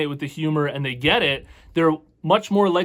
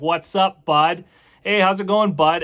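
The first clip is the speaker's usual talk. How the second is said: higher, louder rural Canadian accent; 4 semitones higher, 5 dB louder, slower than usual. Shown as a demonstration. Showing viewers how Canadians greet a bud, in a strong Canadian accent. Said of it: "Canadian accent to it".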